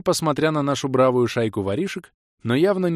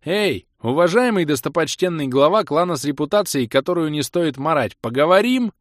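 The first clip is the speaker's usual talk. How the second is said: higher, louder pointed hail; raised voice; 6 semitones higher, 5 dB louder than usual. A narrator's reading. Leader hailing the dazed clan head, raised voice. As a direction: call out insistently, ending high. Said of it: "повысив голос".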